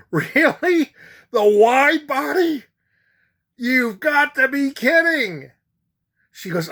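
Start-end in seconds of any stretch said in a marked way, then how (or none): 0.1-5.5 high-pitched, mock-outraged disbelief; incredulous protest. Passenger told there's no wide-body plane, incredulous protest. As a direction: squeal back in exaggerated disbelief.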